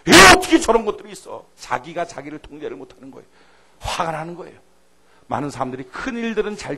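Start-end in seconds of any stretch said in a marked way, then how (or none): 0.1-1.4 loud, indignant shout; angry outburst. Acted. Preacher voicing an angry David, shouting indignantly. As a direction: spit it out in fury.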